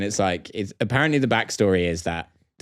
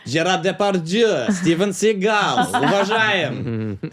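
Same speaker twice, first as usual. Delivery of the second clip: slow, higher, drawn-out mock Russian salute; lightly accented Russian "уважаем" drawn out slowly; mock Russian respect; 7 semitones higher, much slower than usual. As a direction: stretch out the names like a proud Russian.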